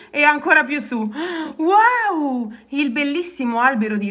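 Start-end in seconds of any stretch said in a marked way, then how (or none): 1.6-2.5 high, drawn-out gasp of wonder; wide-open amazed exclamation; awed wow. A narrator's reading. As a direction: marvel at something enormous. Rana sees the enormous tree.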